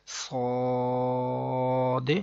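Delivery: صاد with long heavy vowel, bounced d; elongated letter. Shown as a demonstration. Teacher models it for memorizing, long-held صاد. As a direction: stretch صاد evenly, release the d. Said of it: "ایسے ہی یاد کریں گے".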